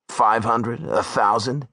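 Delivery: ordinary delivery, nothing marked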